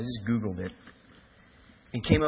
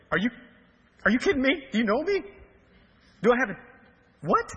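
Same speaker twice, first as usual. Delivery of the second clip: high, disbelieving questions, pitch climbing; can't believe he's asking me; incredulous; 9 semitones higher, 4 dB louder than usual. Retold or imitated. Pastor to homeless man, high incredulous disbelief.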